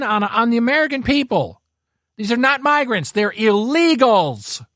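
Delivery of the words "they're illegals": slow, heavy stress on illegals; emphatic. Real, not acted. Ranting to viewers about migrants, slow emphatic insistence. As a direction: lean hard on illegals, word by word. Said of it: "I'll keep shouting it".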